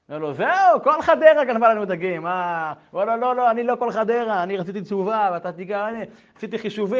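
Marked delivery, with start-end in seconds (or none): 0.3-2.7 much higher and louder, indignant; outraged shout. Retold or imitated. Kibbutz fish officer outraged at the confession, loud shout. 3.2-7.0 loud, higher pleading insistence; insistent protest. Retold or imitated. The rabbi insisting to the officer, loud and higher.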